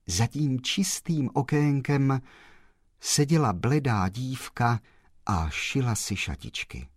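plain reading voice

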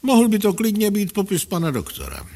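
high, amused teasing voice; playfully amused, dropping at the end. A narrator's reading. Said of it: "řekl inspektor pobaveně".

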